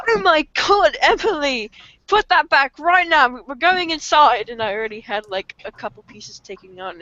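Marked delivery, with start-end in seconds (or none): 0.1-4.5 high-pitched mock scolding; scolding mom. Mom catching kid with taken gum, scolding. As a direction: order the child sharply in a raised voice.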